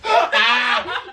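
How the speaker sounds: loud, high laughing shriek. Real, not acted. Cracking up at co-host's joke, high laughing shriek.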